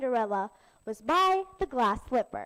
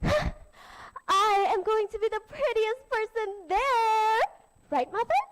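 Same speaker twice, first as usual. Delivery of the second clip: high, drawn-out boastful sister voice; self-satisfied storybook sister, pitched high; exclaiming older stepsister; 6 semitones higher, slower than usual. A narrator's reading. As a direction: boast in a high voice, stretching the words. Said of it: "exclaimed the older sister".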